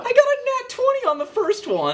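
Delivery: high, loud disbelieving cry; excited. Real, not acted. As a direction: shout it in disbelief. Rolling two natural twenties.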